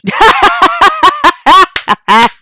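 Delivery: huge, high, loud monstrous laugh; booming creature laugh. Acted. Behemoth laughing at them, booming loud laugh. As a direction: let a giant laugh roar out.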